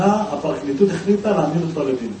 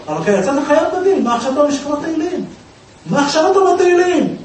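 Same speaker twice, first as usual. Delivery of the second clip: incredulous outburst; 10 semitones higher, 3 dB louder than usual. Retold or imitated. Judge baffled by defendant praying.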